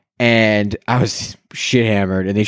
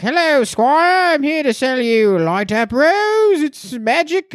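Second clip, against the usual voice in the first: much higher, slow, theatrical peddler voice; costumed rose seller pitching magic light-up roses; medieval vendor; slower than usual. Retold or imitated. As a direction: ham up a courtly rose seller.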